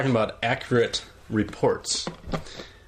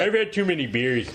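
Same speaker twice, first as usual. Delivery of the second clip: higher cartoon oaf voice; hammed-up tipsy cartoon dad; Homer Simpson impression; 4 semitones higher than usual. Co-host as Homer after drinking, cartoonish. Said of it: "What if Homer Simpson did it".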